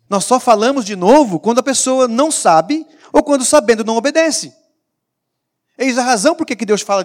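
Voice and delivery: loud, high preacher's proclamation; preaching at full force. Acted. Preacher to congregation on hearing again, loud proclamation. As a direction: lift it and ring it out over the church.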